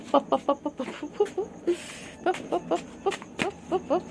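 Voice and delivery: high playful pop-pop calls; playful high calls to a cat. Real, not acted. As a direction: chirp the word up high.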